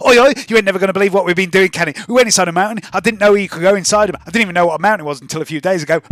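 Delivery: put-on high, loud, rapid babbling kid voice; excitable girl Spring. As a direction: gush breathlessly like an over-eager street kid.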